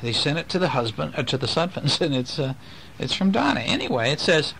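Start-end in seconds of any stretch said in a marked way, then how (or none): none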